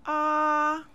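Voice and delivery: "A" held high and level; high flat "A"; first tone. Shown as a demonstration. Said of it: "say each tone after me".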